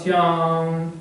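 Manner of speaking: श्याम with a very long level आ; hugely stretched श्याम; prolonged vowel. Shown as a demonstration. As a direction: call श्याम, holding the vowel steady. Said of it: "अब मैं वैसे बोला हूँ".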